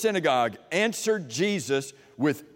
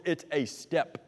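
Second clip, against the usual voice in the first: ordinary talk, nothing marked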